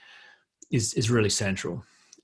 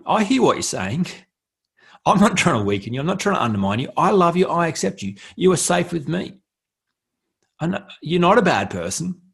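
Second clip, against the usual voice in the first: raised, earnest reassurance to yourself; reassuring self-talk; 6 semitones higher, 4 dB louder than usual. Pretend. Speaking to your own hurt self, reassuring. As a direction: reassure the frightened part of you, loud and kind.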